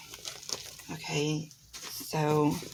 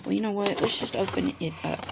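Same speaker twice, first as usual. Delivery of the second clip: ordinary talk, nothing marked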